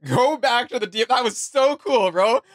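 giddy disbelief at a cool line; laughing with delight. Real, not acted. Streamer reacting to the champion's taunt, laughing with delight.

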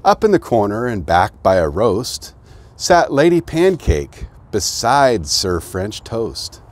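lively rhyming storybook read; bouncy picture-book narration; reading to children. A narrator's reading. Reads picture book aloud.

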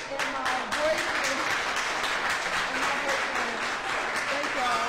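loud, voice breaking with real tears; tearful thanks. Real, not acted. Graduate thanking family who traveled from Chicago, crying openly. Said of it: "I wanna cry".

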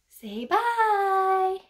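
high, sing-song voice to a pet; cooing at her snake; pet talk. Real, not acted. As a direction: coo at the snake to wave hello.

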